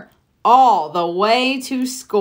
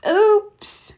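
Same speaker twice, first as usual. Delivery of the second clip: embarrassed oops; 11 semitones higher than usual. Acted. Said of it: "cried Froggy".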